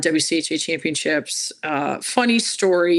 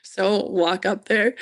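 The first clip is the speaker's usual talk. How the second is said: ordinary talk, nothing marked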